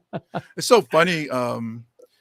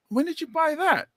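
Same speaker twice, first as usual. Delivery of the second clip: surprised question; 11 semitones higher than usual. Retold or imitated. Confronting shoplifting nephew.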